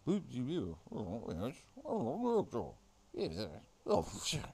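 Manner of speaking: garbled English as foreigners hear it; mock English. Wondering what English sounds like, mock English gibberish. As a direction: mumble loose English-sounding murmurs. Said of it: "I wonder what English sounds like".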